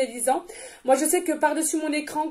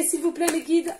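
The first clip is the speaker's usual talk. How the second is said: usual speaking voice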